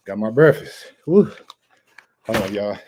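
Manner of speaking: panting after a run; breathless, gulping air between words; out of breath. Real, not acted.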